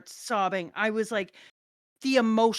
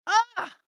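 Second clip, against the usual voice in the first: excited squeal; 12 semitones higher, 7 dB louder than usual. Real, not acted. Gushing about favorite scene.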